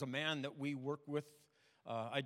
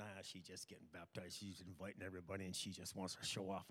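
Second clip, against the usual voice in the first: grumbling attitude; 5 semitones lower, 5 dB quieter than usual. Retold or imitated. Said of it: "with an attitude".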